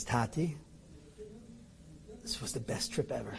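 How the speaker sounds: very soft, gentle son's voice; quiet loving reassurance from the son; tender reply. Retold or imitated. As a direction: say it softly, lovingly.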